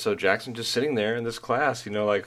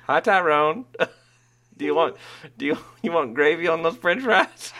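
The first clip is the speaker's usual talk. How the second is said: cheery lunch lady; 7 semitones higher, 4 dB louder than usual. Retold or imitated. Cafeteria ladies greeting Tyrone.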